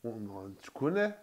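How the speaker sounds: lightly voiced lad from the neighbourhood, casual; a hint of a mate's street talk; imitating street slang. Mocking how lads in the area greet each other, lightly voiced. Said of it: "le fameux, ça dit quoi?".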